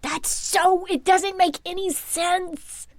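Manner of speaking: high-pitched baffled protest; exasperated disbelief. Real, not acted. Host baffled by an indoor living room pool, exasperated disbelief. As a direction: whine in comic bewilderment.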